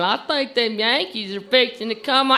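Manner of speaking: hammed-up Southern scout; Southern accent. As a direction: report trouble in a leisurely Southern accent. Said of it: "I love that Southern accent".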